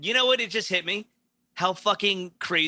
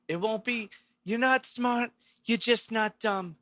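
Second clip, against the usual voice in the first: higher politician voice, fast finish; Cuomo impression; 6 semitones higher than usual. Doing Cuomo for the hosts, higher mock governor voice. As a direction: play the New York governor lecturing. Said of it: "his voice being that high or breathy".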